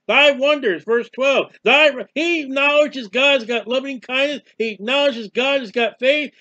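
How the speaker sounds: high, loud, insistent testimony; forceful preaching. Real, not acted. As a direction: pound out each phrase like a fired-up preacher.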